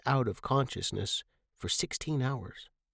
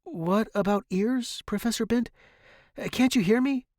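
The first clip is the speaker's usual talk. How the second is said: high, louder questioning; anxious confusion; 11 semitones higher, 3 dB louder than usual. A narrator's reading. Student to whispering professor, anxiously puzzled. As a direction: ask urgently, pitch raised.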